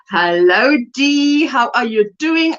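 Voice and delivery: ordinary talk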